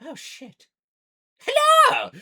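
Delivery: very high-pitched, flustered gnome; quick curse, then a loud squeaked greeting; excited gnome. Acted. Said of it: "look towards you again and go".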